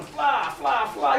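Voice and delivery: usual speaking voice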